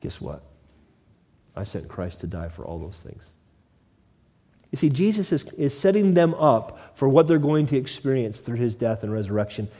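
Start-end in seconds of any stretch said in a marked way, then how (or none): 0.0-3.3 very soft and low, almost breathed; a hush for God's own words. Acted. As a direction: drop right down as God speaks gently. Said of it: "Because then God can say".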